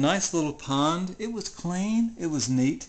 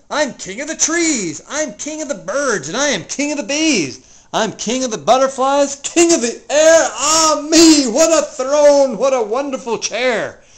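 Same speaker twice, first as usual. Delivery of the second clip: ecstatic gloating; 6 semitones higher, 8 dB louder than usual. Acted. King gloats from high throne.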